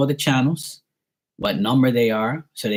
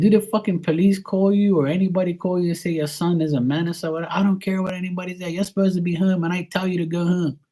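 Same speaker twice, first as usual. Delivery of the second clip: higher, raised arguing voice; heated quarrel; 8 semitones higher than usual. Retold or imitated. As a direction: push the argument, fast and heated. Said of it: "I said".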